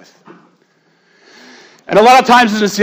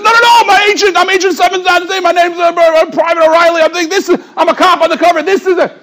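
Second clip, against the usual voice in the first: frantic pleading; 11 semitones higher, 4 dB louder than usual. Retold or imitated. Undercover cop pleads frantically.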